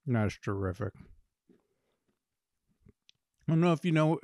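chewing between words; talking while chewing. Real, not acted. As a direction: mouth partly full of cookie. Seen 0: Bitten cookie in hand, jaw working while he talks.

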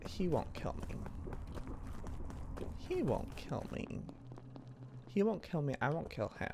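very soft, private murmur; hushed self-talk under the breath; muttering to self. Real, not acted. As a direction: quietly reassure yourself. Muttering to self while playing.

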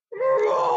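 long, loud high roar; dinosaur roar. Acted. Storyteller leads kids on count of three, dinosaur roar. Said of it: "give me your best dinosaur roar".

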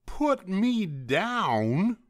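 high, slow, loud outraged rabbit; indignant shout. A narrator's reading. Rabbit grabbed by Douglas, drawn-out indignant shout. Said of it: "shouted the rabbit".

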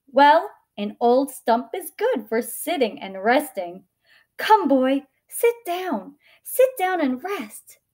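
loud, rising, encouraging invitation; rally and urge, pitch lifting as it goes; tree rallying. A narrator's reading. Tree offering the boy a seat, loud and welcoming. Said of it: "straightening herself up".

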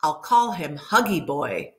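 usual speaking voice

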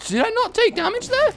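pleasantly amazed, high voice; surprised. Real, not acted.

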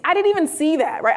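ordinary delivery

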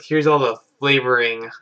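normal talking voice

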